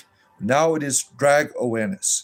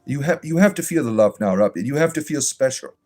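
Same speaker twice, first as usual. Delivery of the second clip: syrupy, sing-song reassurance, hammed-up; mock pastoral comfort; 3 semitones higher than usual. A mock pastor comforting Robert, syrupy and over-sweet. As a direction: lay the fake sympathy on thick like a gushing pastor.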